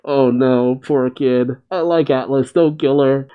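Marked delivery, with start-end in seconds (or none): none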